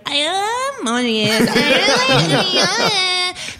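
put-on high, drawn-out girly squeal; gushing girly girl. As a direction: parody an emoji-obsessed girl, high and stretched. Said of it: "be the girl who's like".

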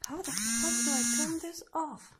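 fed up, swear at the machine; real frustration. Real, not acted. Fighting with a device.

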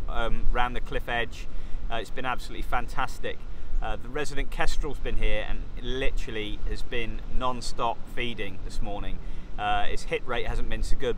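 ordinary talk, nothing marked

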